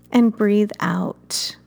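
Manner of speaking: gentle meditation guide, unhurried; guided meditation. Acted. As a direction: ease the listener into calm breathing. Leads a guided fire meditation.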